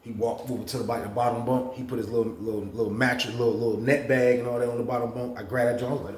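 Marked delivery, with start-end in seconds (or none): none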